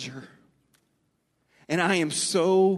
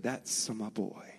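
the father's words, softer and lower, slow with emphasis on That's; softer, lower and slower, as if heard in memory; soft remembered dad voice; 4 semitones lower, 10 dB quieter, slower than usual. Retold or imitated. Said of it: "I can still hear him saying".

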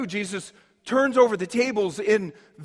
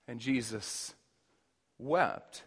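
hushed; 10 semitones lower, 11 dB quieter, slower than usual. Acted. Sermon turns hushed at Jesus weeping.

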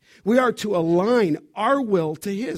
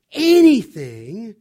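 "Anything" loud and drawn out; stress on Anything; 8 dB louder, slower than usual. Real, not acted. Preacher quoting the verse on prayer, "Anything" stressed loud and slow. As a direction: hit "Anything" hard, stretching it slowly.

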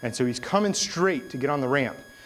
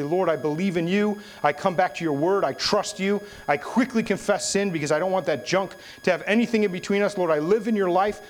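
higher, driving declaration of faith; fervent prayer; 6 semitones higher, 3 dB louder than usual. Shown as a demonstration. Preacher showing what to say to God, fervent. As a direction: pray it out with building urgency.

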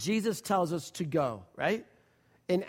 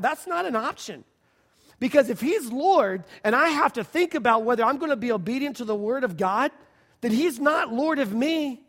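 higher and louder, building fervor; preaching at full force; 4 semitones higher, 5 dB louder than usual. Real, not acted. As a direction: proclaim it to the congregation. Preaching climax.

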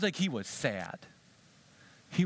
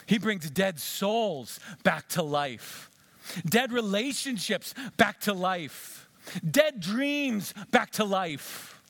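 slow, louder, emphatic refrain; preaching climax; 8 semitones higher, 4 dB louder, much slower than usual. Real, not acted. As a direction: hammer each line home.

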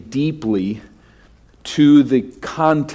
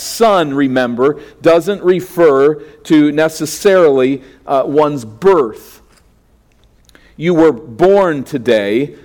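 raised, louder sermon voice pressing each point; preaching; 4 semitones higher, 4 dB louder than usual. Acted. Pastor driving home Christ's enthronement to the congregation, preaching. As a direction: preach it with growing conviction.

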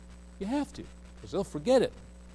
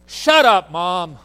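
high, loud snap of shut up; child's rude shout; 3 semitones higher, 13 dB louder, much slower than usual. Retold or imitated. Little boy shouting back at his mom, high and loud. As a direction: blurt it like a rude four-year-old. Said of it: "Whoa. Boy, my head jerked up".

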